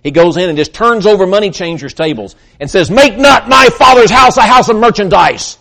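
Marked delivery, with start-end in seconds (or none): 2.9-5.6 high, loud commanding cry; angry rebuke. Acted. Jesus rebuking the money changers in the temple, angry shout. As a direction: drive them out with a furious shout.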